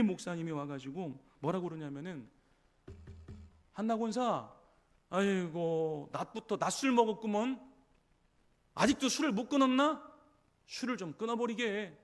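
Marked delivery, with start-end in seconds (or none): 3.7-6.1 mocking scold. Eli scolding praying Hannah as drunk. 6.1-7.6 mock accusation. Eli mocks Hannah as drunk. 8.7-12.0 mocking scold. Eli scolding praying Hannah as drunk.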